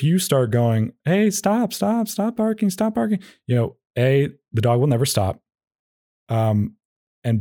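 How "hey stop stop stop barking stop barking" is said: much higher, sing-song plea to a barking dog; mock the owner's uplifting plea, pitched way up; encouraging pleading tone. Owner pleading with barking dog, mock sing-song high. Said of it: "because you're embarrassed of the dog".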